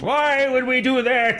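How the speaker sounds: high, slow whiny mimicry; mock whiny mother. Player parroting the on-screen mother, high and whiny. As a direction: drag it out, parodying the nagging mother. Echoing the game mother's line.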